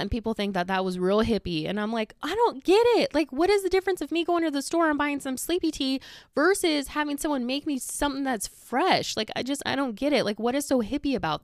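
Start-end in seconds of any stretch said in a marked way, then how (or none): 2.2-9.2 high-pitched, worked-up bafflement; exasperated retort. Retold or imitated. Answering people who call herbal tea hippie, exasperated. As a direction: protest in exasperation, voice jumping up.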